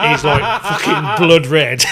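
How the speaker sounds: can barely get it out for laughing. Real, not acted. Telling the funny McDonald's story, laughing loudly.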